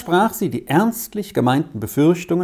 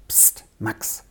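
much softer, fearful whispered hush; anxious whisper; 4 semitones lower, 10 dB quieter than usual. A narrator's reading. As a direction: hush him, afraid of being heard. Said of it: "sagte Elsa ängstlich". (ängstlich geflüstert)

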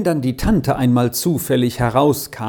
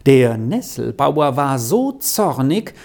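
ordinary delivery

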